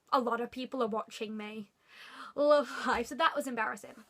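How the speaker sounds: ordinary talk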